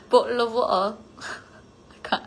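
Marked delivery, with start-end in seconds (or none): 0.1-1.0 British accent breaking into laughter. Shown as a demonstration. Streamer failing a viewer's dare, giggly British accent. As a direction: attempt British while cracking up. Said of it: "say bottle of water in British accent".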